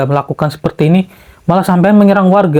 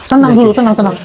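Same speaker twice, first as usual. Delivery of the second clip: urgent calling out; 6 semitones higher, faster than usual. Acted. Calming his raging friend.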